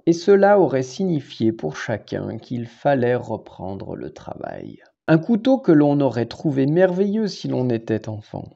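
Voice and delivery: unhurried literary read-aloud in a low register; poetry reading. Acted. Reads the prose poem aloud.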